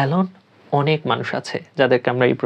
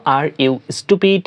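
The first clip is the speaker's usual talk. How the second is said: drawn-out model question; teacher repeating slowly; slow demo; much slower than usual. Shown as a demonstration. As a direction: say it slowly so students copy. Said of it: "বলার ক্ষেত্রে বলবো".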